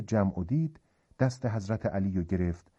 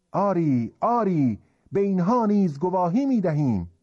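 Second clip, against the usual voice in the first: loud, high-pitched emphatic assent; crowd affirming in unison; 9 semitones higher, 5 dB louder than usual. Acted. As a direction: call out agreement as a whole crowd. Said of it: "یه صدا گفتند".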